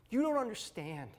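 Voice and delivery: high-pitched, retold desperate plea; shaky voice. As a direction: re-enact a plea bursting out shakily. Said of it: "with a shaky voice".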